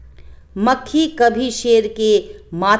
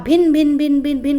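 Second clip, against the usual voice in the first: buzzing sound; 5 semitones higher, 3 dB louder than usual. A narrator's reading. Buzzing fly sound in story.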